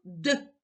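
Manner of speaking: «д» as a short hard d, not palatalized; hard consonant sound. Shown as a demonstration. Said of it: "Твердий звук".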